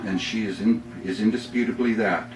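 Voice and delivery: upbeat poem read with comic timing; humorous poem reading. Acted. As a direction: deliver the punchlines with a grin. Reads his humorous poem.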